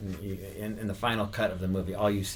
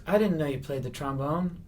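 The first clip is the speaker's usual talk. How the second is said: high-pitched character voice; imitated character, pitched up; Andy Kaufman; 7 semitones higher, 3 dB louder than usual. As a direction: remark on it in a put-on high voice. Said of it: "staying in the Andy Kaufman character".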